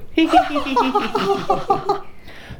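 high squealing penguin laughter; uproarious laugh. Acted. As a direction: burst out in shrill cartoon laughter. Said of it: "laughed uproariously".

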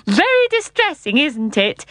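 high-pitched, mirthful regret; amused uncle. A narrator's reading. Uncle to Jane and Michael, highly amused. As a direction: start high and giggly, then settle.

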